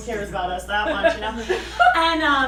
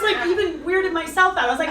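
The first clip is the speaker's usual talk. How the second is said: usual speaking voice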